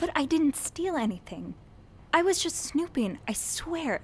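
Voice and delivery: flustered pleading; pleading protest. Acted. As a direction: insist on your innocence. Defending herself, pleading.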